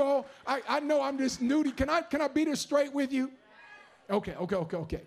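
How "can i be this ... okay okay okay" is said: ordinary delivery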